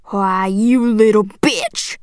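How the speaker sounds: very slow, drawn-out insult; menacing anger. A narrator's reading. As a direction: draw it out with cold fury. Father stiffens, insults the girl.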